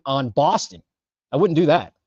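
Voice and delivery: ordinary delivery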